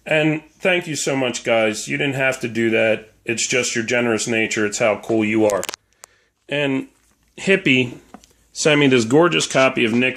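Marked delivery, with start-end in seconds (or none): none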